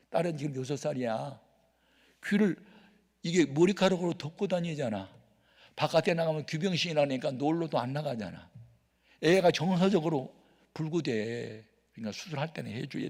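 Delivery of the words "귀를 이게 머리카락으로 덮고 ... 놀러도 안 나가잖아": normal talking voice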